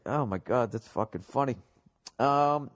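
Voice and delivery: usual speaking voice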